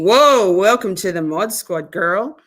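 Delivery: ordinary talk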